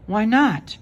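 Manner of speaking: high, pouting why not; a little girl's indignant question; child protesting. A narrator's reading. Frances objects to her mother, pouting.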